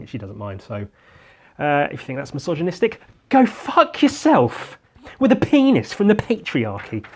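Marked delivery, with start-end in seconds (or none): 3.3-7.1 hammed-up furious retort; mock angry.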